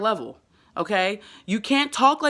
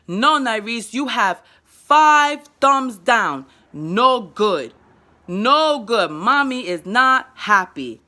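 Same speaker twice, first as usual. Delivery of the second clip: slow, louder broken English; slow scolding child talk; 4 dB louder, slower than usual. Shown as a demonstration. Mother telling son about five thumbs down, slow simple scolding. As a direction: scold gently and slowly in toddler words. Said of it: "it sounds like I'm talking crazy".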